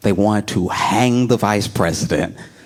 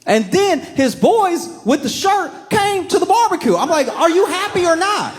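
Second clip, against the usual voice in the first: mock shout. Rioters' buddies crash the barbecue.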